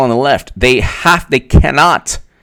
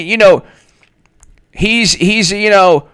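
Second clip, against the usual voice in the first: ordinary talk, nothing marked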